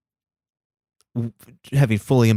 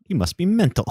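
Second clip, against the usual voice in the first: higher, lightly voiced cheeky retort; a touch of Davy's British quip; 9 semitones higher than usual. Refusing to dance for the producers, in a light British quip. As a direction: toss it off like a cheeky Brit.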